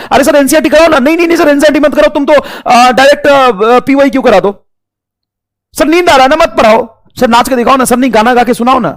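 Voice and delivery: high, loud pleading student voice; mocking whiny student. Teacher imitating students nagging in comments, loud whine. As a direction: whine like a spoilt kid begging sir.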